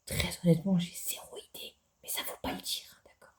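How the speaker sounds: confiding whisper, barely audible. Pretend. Gamer admitting a secret to viewers, whispered. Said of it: "mon chuchotement".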